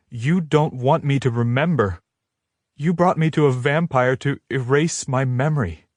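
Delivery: high, hurt realization; accusing. A narrator's reading. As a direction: piece it together, hurt.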